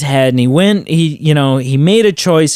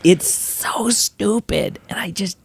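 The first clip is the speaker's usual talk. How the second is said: exasperated whisper; 4 semitones higher, 7 dB quieter than usual. Real, not acted. Exasperated rant about Batman voice.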